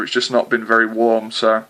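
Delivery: ordinary talk, nothing marked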